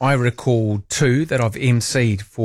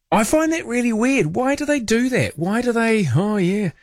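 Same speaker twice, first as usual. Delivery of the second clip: incredulous; 9 semitones higher than usual. Real, not acted. Kiwi singer claimed by Australia.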